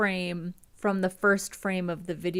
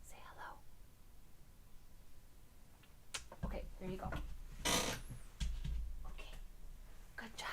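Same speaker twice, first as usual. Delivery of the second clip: soft whisper to the dog; 15 dB quieter than usual. Real, not acted. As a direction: hush it, gently praising.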